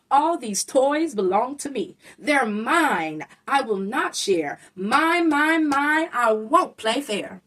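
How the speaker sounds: put-on loud possessive whiny kid; greedy child. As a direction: stake your claim on every toy. Greedy child claims toys.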